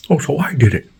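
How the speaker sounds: high sarcastic neighbor voice; high mock indignation. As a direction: throw it back high and snide.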